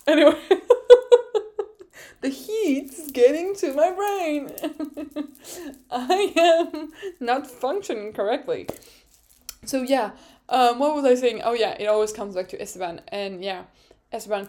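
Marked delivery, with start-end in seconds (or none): 0.1-8.7 high, talking through laughter; giggly. Real, not acted. Vlogger blaming the heat for her brain, giggly. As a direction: say it breaking up in giggles.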